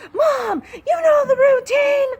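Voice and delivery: high, loud, whining imitation of an angry daughter; squealing teen complaint, hammed up; whiny mock daughter. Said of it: "she was so pissed".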